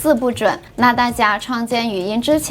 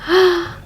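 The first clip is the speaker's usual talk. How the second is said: startled gasp; 8 semitones higher than usual. Real, not acted. Startled by whispered clip.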